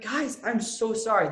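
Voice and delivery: slow, exaggerated self-quoted apology; apologetic protest. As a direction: plead your case to annoyed parents. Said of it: "I'm like".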